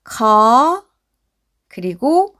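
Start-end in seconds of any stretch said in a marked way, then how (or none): 0.0-0.8 slow, drawn-out 커; sounding out. Shown as a demonstration. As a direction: sound out 커 as one long, held syllable. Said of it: "앞에서부터 읽어보면". (커를 길게 끌어 또박또박)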